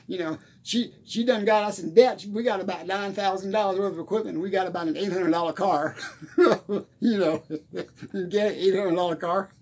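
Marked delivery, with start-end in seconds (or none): 4.4-9.6 chuckling through the words; laughing. Real, not acted. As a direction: laugh while saying it.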